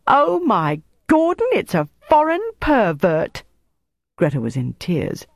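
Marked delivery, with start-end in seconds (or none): 0.1-3.4 triumphant mockery. A narrator's reading. Jelly gloating at Greta's exposed secret, triumphant. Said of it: "she said triumphantly".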